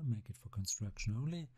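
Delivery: normal talking voice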